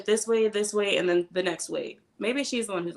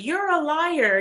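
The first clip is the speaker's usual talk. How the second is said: much higher, jabbing accusation; mock accusation; 9 semitones higher, 4 dB louder, much slower than usual. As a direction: call her a liar, pointed and high. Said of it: "when Stacey's like".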